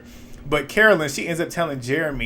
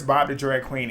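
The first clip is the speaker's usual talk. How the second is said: ordinary talk, nothing marked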